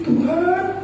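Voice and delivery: mock-mournful prayer, thin and high; sad plea. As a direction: plead sorrowfully, high and small. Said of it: "Saya sedih".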